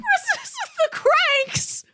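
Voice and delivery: high, slow squeak of disbelief; incredulous echo. Real, not acted. Cohost hearing the odd film pick, squeaky disbelief. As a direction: repeat the title like you can't believe it. Cohost echoes the surprising title.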